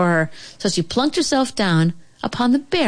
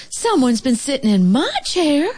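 higher-pitched mama bear voice; middle-sized motherly complaint; 8 semitones higher than usual. A narrator's reading. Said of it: "in her middle-sized voice".

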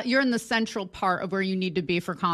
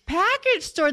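mock locals; 8 semitones higher, slower than usual. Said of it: "They're like".

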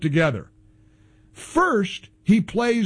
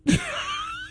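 scornful laugh; 5 dB quieter than usual. Real, not acted. Host laughs at networks.

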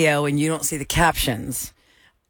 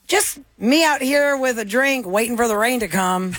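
quoted post; 8 semitones higher than usual. Retold or imitated. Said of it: "I saw post".

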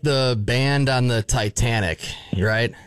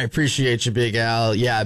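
ordinary delivery

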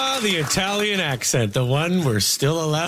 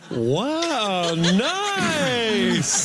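impressed wow; 5 semitones higher than usual. Retold or imitated. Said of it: "everyone was like".

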